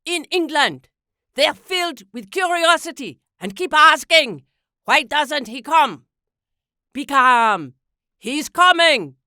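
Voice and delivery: lightly voiced Hitler teasing the crowd; Hitler joking. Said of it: "he delivered as a joke".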